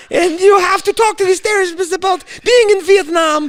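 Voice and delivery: high, loud mocking mother voice; mom voice. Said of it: "what did your mother say?".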